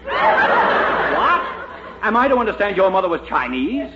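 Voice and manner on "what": shocked what. Acted. Counsel shocked by Chinese mother.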